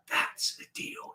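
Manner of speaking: slow, breathless whisper of certainty; awed whisper. Retold or imitated. Spotting the killer deal, he whispers in slow awe. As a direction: breathe it out, barely voiced and slow.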